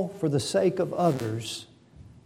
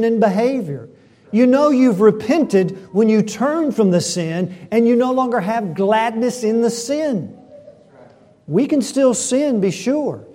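higher and louder, driving each phrase home; full-force preaching; 6 semitones higher, 7 dB louder than usual. Acted. Pastor pleading with his congregation about sexual immorality, full-force preaching. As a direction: preach it hard to the whole room.

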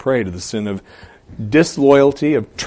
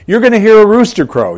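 high, loud "rooster crow"; forceful, raised insistence on "rooster"; emphatic; 12 semitones higher, 10 dB louder than usual. Acted. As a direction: drive home "hear a rooster crow" with raised pitch. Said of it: "He's emphatic about the sign".

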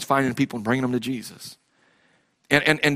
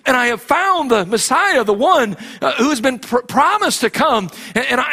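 preaching at full force; 7 semitones higher than usual. Acted. Pastor urging his congregation to share their faith, preaching at full force. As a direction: preach it hard to the congregation, building.

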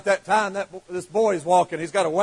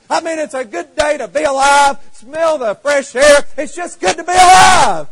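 higher and louder, slowing on smell the fresh air; joyful exclaiming; 5 semitones higher, 8 dB louder than usual. Acted. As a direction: burst with the joy of being alive. Happy man exults.

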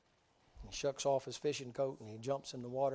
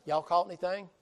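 Southern Jesus calling out loud from the shore; a loud Southern "Y'all caught anything?" shouted across the water; 4 semitones higher, 9 dB louder than usual. Acted. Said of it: "He's a Southern Jesus".

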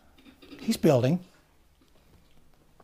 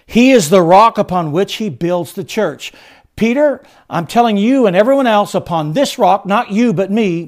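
loud, raised pitch, driving sermon delivery; forceful preaching; 5 semitones higher, 9 dB louder than usual. Acted. Preacher tells congregation what the rock is, forceful.

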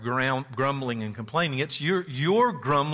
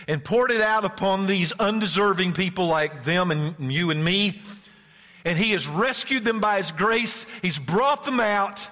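loud, higher, slow proclamation; full-force preaching; 8 semitones higher, 6 dB louder than usual. Acted. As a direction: preach it over the congregation.

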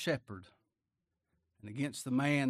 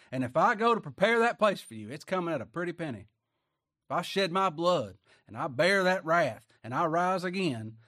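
fiery preaching; 6 dB louder than usual. Acted. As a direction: thunder it from the pulpit. Preaching at full force.

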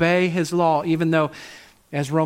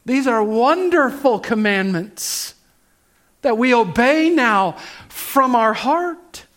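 rising, louder preaching swell; louder, higher, slower emphatic praise; fervent build; 6 semitones higher, 5 dB louder than usual. Real, not acted. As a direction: lift it with growing fervour.

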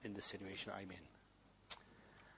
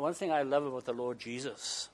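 normal talking voice

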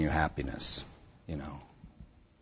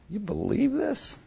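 high, slow, incredulous aside; mock disbelief; slower than usual. To his friend during the Ouija, high slow disbelief. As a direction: play the sceptic, pitched way up.

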